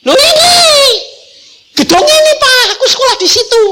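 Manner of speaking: high, loud, laughing discovery; excited girl. Retold or imitated. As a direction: squeal it like a girl who just found the building. Daughter recognizes building, excited.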